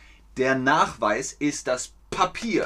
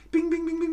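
squeaky high-pitched bings like a machine; playful high chime noises; high bing sound effect; much faster than usual. Acted. Sound effect for passing test.

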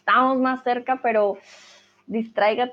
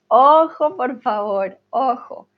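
loud, raised "watch out"; emphatic warning; 2 semitones higher, 5 dB louder than usual. Real, not acted. Teacher warns students about a common mistake, emphatic warning.

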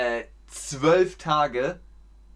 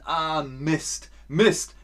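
annoyed exclamation; 3 semitones higher, much slower than usual. Shown as a demonstration. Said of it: "und dann sagt man".

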